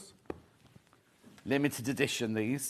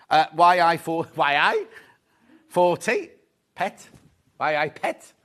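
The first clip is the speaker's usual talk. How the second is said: a hint of a Geordie accent, teasing; 7 dB louder than usual. Reading the fabric code out in Geordie, light Geordie accent. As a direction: slip into a light Geordie lilt. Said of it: "Why are you doing that accent?".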